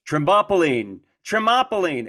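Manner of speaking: excited mock yell. Fan imitating Homer Simpson spotting a trampoline ad, excited mock yell. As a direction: yell it, too excited to get the word out. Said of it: "and he yells".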